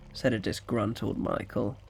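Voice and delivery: usual speaking voice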